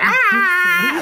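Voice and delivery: very high squealed "Ah!"; mock squeal for a silly publicity face; imitated face noise. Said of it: "Like that face".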